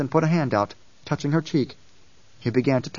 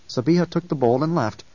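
usual speaking voice

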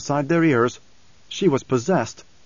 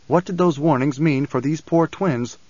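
plain reading voice

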